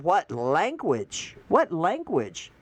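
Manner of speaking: slow, drawn-out what language; slow disbelief. Real, not acted. Reacting to the Democrats' insult with slow disbelief. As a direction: stretch each word out in disbelief. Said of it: "slow mock disbelief".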